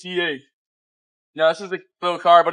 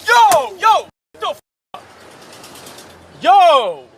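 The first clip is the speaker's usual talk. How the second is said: sudden shocked shouts, high and loud; startled yelling; 14 dB louder, slower than usual. Real, not acted. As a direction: yell out in real shock. Windshield smashed, startled yelling.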